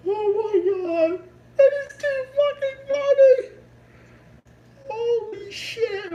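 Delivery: high squealing laughter; tears of laughter; crying laughing. Real, not acted. Laughing again, can't compose himself.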